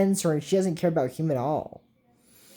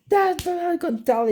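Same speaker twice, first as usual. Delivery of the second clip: much higher put-on voice; mock character voice; 13 semitones higher than usual. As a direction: squeak it out in a silly character voice. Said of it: "And he goes".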